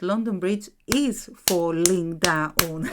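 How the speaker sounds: loud, emphatic syllable-by-syllable delivery; forceful Greek-style weight on each syllable; mock arguing. Shown as a demonstration. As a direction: insist on it loudly, every syllable hammered. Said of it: "It's not that we are arguing".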